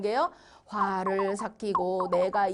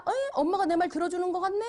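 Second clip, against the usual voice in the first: put-on high, quick child's voice; scheming child; 8 semitones higher, much faster than usual. Child thinking mom will give in, perking up. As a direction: play a kid realising it's working, pitched up and fast.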